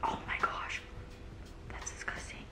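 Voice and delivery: hushed, whispered disgust; barely voiced revulsion; appalled whisper. Real, not acted. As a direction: whisper it in horror to yourself. Appalled at what camera shows.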